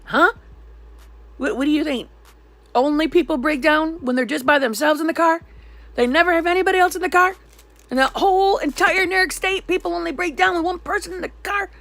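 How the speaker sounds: loud, high-pitched sarcastic questions; indignant rant. Retold or imitated. Angry caller challenging triple A about passengers, indignant rant. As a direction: raise pitch and volume in exasperated disbelief. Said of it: "I'm a lot more calm about it now".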